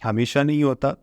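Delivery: ordinary talk, nothing marked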